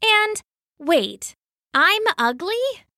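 can't believe what she heard; wounded little frog asking; shocked question. Acted.

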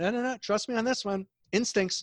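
lightly acted high, insistent voice; retold insistence. Girlfriend overruling the actor about the audition, high mock insistence. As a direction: cut off the objection, pitched high.

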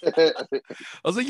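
normal talking voice

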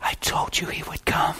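soft unvoiced whisper leaning in close. To the weeping couple at the wedding, a hushed whisper. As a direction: breathe it into their ear like a secret. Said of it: "I leaned in and said".

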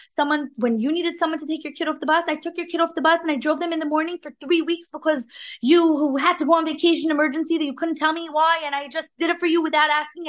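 worked-up mock complaint, tumbling out; indignant telling-off. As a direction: act out an aggrieved friend venting. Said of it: "when we feel like telling someone".

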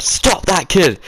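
put-on high-pitched call of Stop that kid; old man shouting at the guard to grab the kid; retold shout. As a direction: yell it at the guard from far off.

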